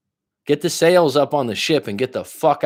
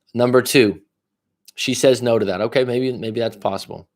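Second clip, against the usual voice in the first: normal talking voice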